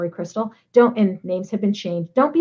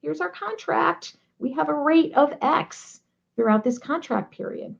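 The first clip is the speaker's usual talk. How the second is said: soft, high, gentle reminder; 7 semitones higher, 5 dB quieter than usual. Shown as a demonstration. Advising a client how to remind the vendor, gently. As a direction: say it kindly and lightly. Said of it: "So gently say".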